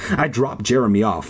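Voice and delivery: plain reading voice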